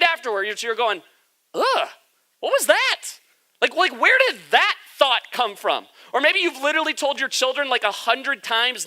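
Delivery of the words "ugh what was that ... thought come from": exasperated at self. Pretend. Pastor voicing a listener's regret after a bad thought, exasperated. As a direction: catch yourself and wince at your own thought. Acting out own regretful inner reaction.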